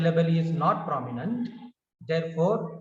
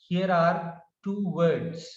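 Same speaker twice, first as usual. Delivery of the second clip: normal talking voice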